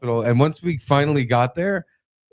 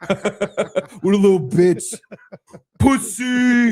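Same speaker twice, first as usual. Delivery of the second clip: high, drawn-out taunt through laughter; hammed-up gleeful ridicule; mocking jeer; 8 semitones higher, 4 dB louder, much slower than usual. Patient imagining doctor laughing at him, jeering loudly. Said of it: "pointing and laughing".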